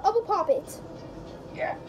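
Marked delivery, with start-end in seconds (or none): none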